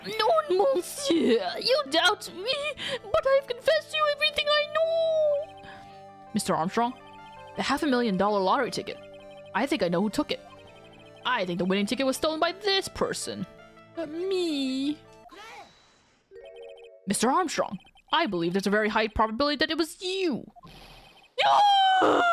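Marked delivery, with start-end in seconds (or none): 0.1-5.6 put-on high, tearful French-accented chef. French chef protests, crying. 21.4-22.2 shrill, very high scream of denial; piercing scream. Acted. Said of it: "that is one piercing scream".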